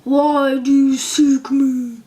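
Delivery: slow, loud, echoing head voice; booming giant head. A narrator's reading. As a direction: loom over her with every slow word. Giant head questions Dorothy.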